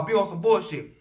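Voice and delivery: ordinary delivery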